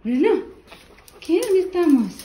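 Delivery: high, sing-song pet voice. Real, not acted. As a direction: coo at the dog in a high voice. Talking to her dog Bruno.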